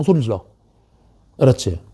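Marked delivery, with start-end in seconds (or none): none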